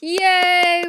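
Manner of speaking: high, loud burst of joy; excited cheer. Real, not acted. Narrator cheering that recording is finished, loud and high.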